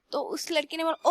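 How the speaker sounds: ordinary delivery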